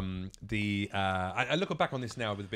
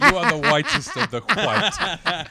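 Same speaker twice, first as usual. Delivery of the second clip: laughing; 8 semitones higher, 8 dB louder, slower than usual. Real, not acted. Teasing laughing exaggeration.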